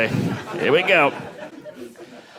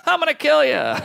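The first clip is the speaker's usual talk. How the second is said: mock killer threat; 11 semitones higher than usual. Bedwetter threatens his friend.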